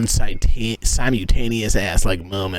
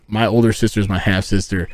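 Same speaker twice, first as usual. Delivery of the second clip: usual speaking voice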